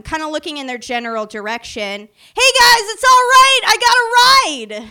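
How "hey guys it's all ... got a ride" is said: much higher and louder, yelled out; drunken shout. Retold or imitated. Drunk woman yelling to her watching friends, loud and high. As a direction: call out to friends across the alley. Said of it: "I just scream at them".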